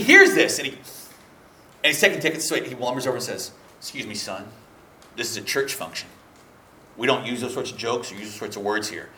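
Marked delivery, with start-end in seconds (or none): 3.8-9.1 low, quiet stern church usher. Retold or imitated. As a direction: lower the voice like a disapproving deacon. Ticket taker scolding, deep voice.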